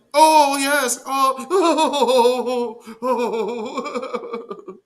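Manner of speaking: high loud cackling oh's fading out; laughing hard. Real, not acted. Host cracking up at the clip, loud then fading. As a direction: laugh until it trails off.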